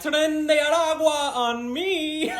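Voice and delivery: drawn-out mock Spanish accent, pitched high; fake Spanish accent. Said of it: "her fake Spanish accent".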